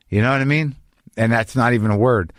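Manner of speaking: ordinary delivery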